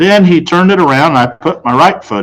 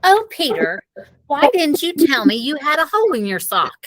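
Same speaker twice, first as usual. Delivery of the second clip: higher, soft mild reproach; mother's voice; 12 semitones higher, 6 dB quieter than usual. A narrator's reading. Mother fretting to Peter over his sock, mildly reproachful. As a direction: fuss at the child gently.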